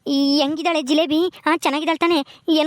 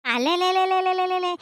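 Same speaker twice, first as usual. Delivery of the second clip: put-on baby talk; 7 semitones higher, slower than usual. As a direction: coo to settle the crying baby. Father cooing at crying baby.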